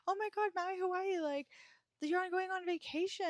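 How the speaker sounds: high-pitched mock excitement; imitated excitement. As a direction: imitate everyone gushing, pitch way up. Imitating everyone's excitement about Hawaii.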